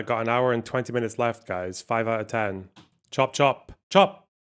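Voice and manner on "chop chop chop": building chop chop. Acted. Hurrying chat along.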